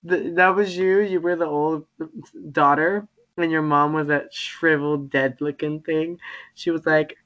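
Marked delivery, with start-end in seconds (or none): none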